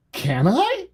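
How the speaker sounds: high, rising hopeful question; mock-eager squeak, sliding sharply upward; imitating eager player. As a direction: pipe up like a player who can't believe the offer.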